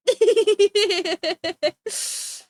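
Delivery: loud, high rising laugh; sudden bursting laugh; burst of laughter. Real, not acted. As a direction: crack up out loud.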